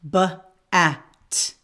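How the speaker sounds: b and at pulled apart and sounded separately; sounding out phonemes. Shown as a demonstration. Teacher sounding out b, at for the class. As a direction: split bat into its sounds. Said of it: "there are three phonemes".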